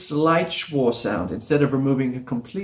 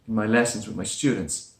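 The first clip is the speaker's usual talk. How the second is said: normal talking voice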